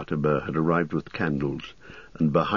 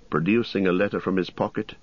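usual speaking voice

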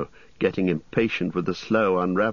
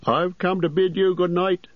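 higher, bustling landlord voice; fussy innkeeper; 13 semitones higher, 3 dB louder than usual. Acted. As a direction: play the chatty innkeeper, pitched up.

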